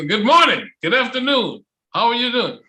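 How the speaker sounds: high and loud cheerful greetings; energetic greeting. Shown as a demonstration. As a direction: fire up the person you greet. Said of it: "I go".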